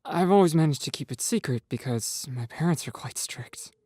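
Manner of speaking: a hint of a shy boy; lightly voiced Nathan, subdued. A narrator's reading.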